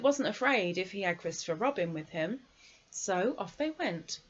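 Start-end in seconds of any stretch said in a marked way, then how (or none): none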